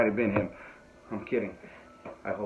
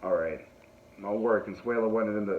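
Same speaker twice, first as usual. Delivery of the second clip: normal talking voice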